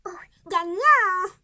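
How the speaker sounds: stretched out, loud and slow; dramatic slow announce. Acted. Announcing the winner, drawn-out loud showman call. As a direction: draw it out like a game-show host.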